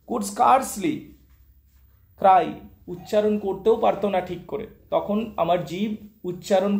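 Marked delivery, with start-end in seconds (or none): none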